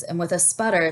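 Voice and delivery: plain reading voice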